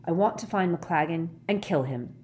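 ordinary delivery

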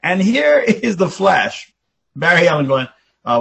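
normal talking voice

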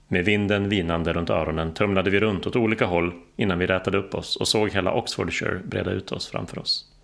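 ordinary delivery

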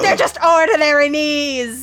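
high, loud rebuttal; bickering, high-pitched yell; scornful creature. Acted. Creature squabbles with the players.

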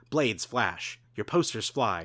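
ordinary delivery

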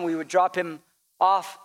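usual speaking voice

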